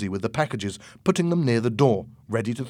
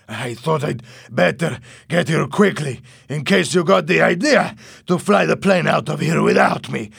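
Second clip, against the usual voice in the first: panting voice from running with packages; breathless, gasping between words; out of breath; 5 semitones higher, 7 dB louder than usual. Acted. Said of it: "out of breath".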